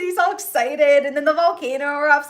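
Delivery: ordinary delivery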